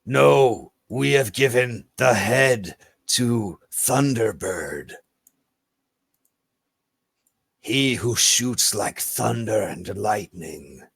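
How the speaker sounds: slow, stately creature voice full of reverence; solemn lizard folk. Acted. Lizard folk honours Thunderbird as great warrior, slow and reverent. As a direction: proclaim it slowly as a reptile hunter.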